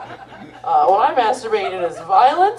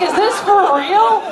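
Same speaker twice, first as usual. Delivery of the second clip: much higher, slow astonished question; amazed disbelief; 11 semitones higher, 3 dB louder, slower than usual. Retold or imitated. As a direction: gasp it out in disbelief. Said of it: "You're like".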